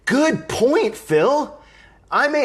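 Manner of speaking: light up at the joke; thrilled shout-out to Phil; delighted. Real, not acted. Delighted at a viewer comment.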